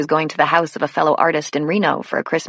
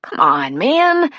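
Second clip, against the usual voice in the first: whining; 5 semitones higher, much slower than usual. A narrator's reading. Caught boy begging the cop to let him off, whining. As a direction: whine that leaps high on the last word. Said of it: "Jason whined".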